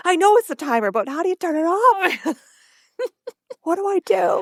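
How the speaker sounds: put-on high, flustered old lady; imitating a frantic grandmother who can't stop a timer; panicky grandma. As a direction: squeak out the helpless question. [name] voiced, high panicked.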